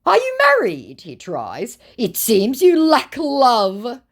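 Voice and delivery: high, loud hammed-up scammer; mock scammer voice. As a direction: play the creepy fake suitor. Voicing the scammer.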